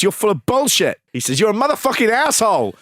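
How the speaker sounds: imitating Kaufman's shouted insults; retold shout. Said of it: "And Kaufman shouts, he shouts".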